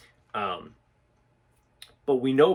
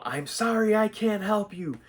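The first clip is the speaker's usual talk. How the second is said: loud, much higher-pitched yelled refusal; forceful yell; 11 semitones higher, 5 dB louder than usual. Shown as a demonstration. Instructor demonstrates warding off a threat, yelling forcefully. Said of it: "I'm going to yell it".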